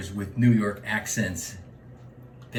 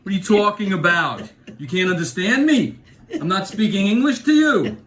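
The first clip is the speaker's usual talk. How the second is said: louder, indignant retort; defiant New Yorker; 7 semitones higher, 6 dB louder than usual. Retold or imitated. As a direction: push back on the critic. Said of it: "gives me shit, and tells me to talk normal".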